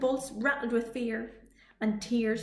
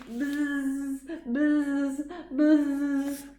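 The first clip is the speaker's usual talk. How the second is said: buzzing bees; 4 semitones higher, much slower than usual. A narrator's reading. Imitating bees.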